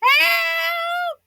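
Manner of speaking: high shouted help; mock cry for help. Teacher buried, cries help.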